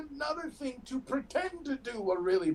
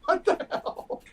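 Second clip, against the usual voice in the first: shocked disbelief; 9 semitones higher, 5 dB louder, slower than usual. Real, not acted. Shocked at prairie dog remark.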